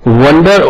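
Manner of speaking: વન્ડર said "WUN-der", as in wonder; short "uh" vowel in વન્ડર. Shown as a demonstration. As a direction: say વન્ડર with an open-neutral "uh", not "o". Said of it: "ત્યાં અ ઉચ્ચાર થાય છે".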